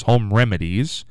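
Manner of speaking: ordinary talk, nothing marked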